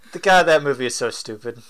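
ordinary talk, nothing marked

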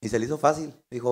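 ordinary delivery

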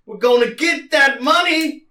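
high, full-volume rallying shout; slow, hammed-up rousing yell, building to the end; Samuel Jackson. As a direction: holler it to fire up the whole crew. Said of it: "maybe that could also be Samuel Jackson then".

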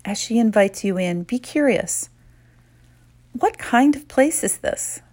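calm, unhurried meditation voice; guided meditation. Acted. Guide leading women leaders through a visualization, calm and soothing. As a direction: lead them gently inward, pausing often.

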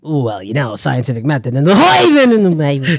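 loud nerdy Frink babble with laughs; sputtering scientist voice; mock Frink rambling; Professor Frink impression. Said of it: "Professor Frink".

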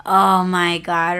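loud, drawn-out exclamation; fed-up and emphatic; exasperated. Retold or imitated. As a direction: groan it slowly in disbelief. Exasperated reaction while retelling the call.

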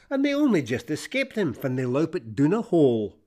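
plain reading voice

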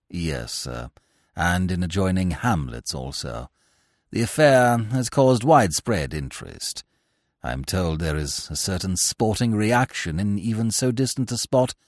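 low, measured manservant's report; Jeeves the valet. Acted. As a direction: report it like an unflappable butler. Jeeves replies to Bertie.

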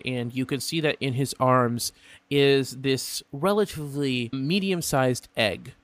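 usual speaking voice

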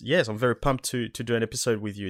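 ordinary delivery

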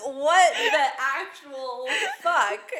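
high, stretched-out outraged disbelief; hammed-up shock breaking into laughter; incredulous. Reacting to friend saving a dress for pregnancy, incredulous.

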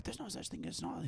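high breathy whisper; whispered little girl. Retold or imitated. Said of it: "that little girl's like".

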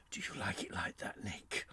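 intimate unvoiced whisper; whispering in the ear. Host teasing co-host in his ear, whispering. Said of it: "someone whispering in your ear".

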